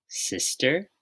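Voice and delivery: ordinary delivery, nothing marked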